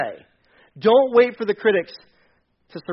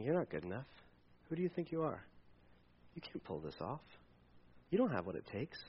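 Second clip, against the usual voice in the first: quiet inner critic; 7 semitones lower, 22 dB quieter than usual. Retold or imitated.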